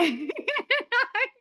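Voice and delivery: a burst of high, loud laughter; real laugh. Real, not acted. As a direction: laugh out loud at your own joke. Laughs at own joke.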